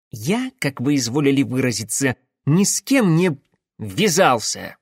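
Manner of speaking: ordinary delivery, nothing marked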